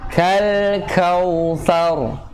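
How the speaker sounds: "Kal kautsar" chanted slowly, both k's clear; tajwid recitation. Shown as a demonstration. Teacher modelling "Kal kautsar" slowly for the student. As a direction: stretch "Kal" and split the two k sounds. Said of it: "Alif lamnya alif lam komariah".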